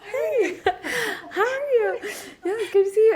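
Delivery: high, giggling hello through laughter; mock-delighted greeting, breaking into giggles; 1 laughing greeting. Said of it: "you know, like".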